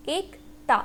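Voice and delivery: एक ता in two separated syllables; pronunciation demo. Shown as a demonstration. Teacher shows learners the pronunciation, sounding out एक ता by syllable. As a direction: model एक, brief break, then ता. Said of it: "इसका pronunciation क्या होगा".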